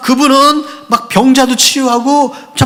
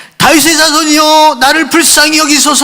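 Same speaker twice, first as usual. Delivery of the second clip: crying out; 7 semitones higher than usual. Retold or imitated. Blind beggar crying out to Jesus for mercy. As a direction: call out for mercy, voice raised high. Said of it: "하고 외치기 시작한".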